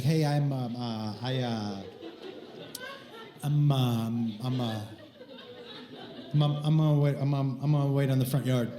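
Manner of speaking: low, halting imitation of a stutter; nervous stutterer. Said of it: "he starts to stutter a little bit".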